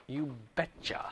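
lightly voiced Minnesotan "You betcha", quiet and casual; a hint of a Minnesota folksy "You betcha"; Minnesotan accent. Teacher joking to the class in a light Minnesotan accent. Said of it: "I think that's called that Minnesotan".

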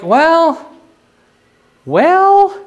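high, drawn-out sceptical "well"; doubtful well. Acted. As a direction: stretch it upward, hedging with doubt. Voicing a doubtful reaction to the claim.

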